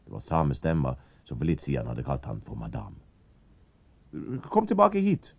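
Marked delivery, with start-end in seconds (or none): 4.5-5.3 high, loud call. Acted. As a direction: summon him back. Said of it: "ropte stemma". (roper ham tilbake)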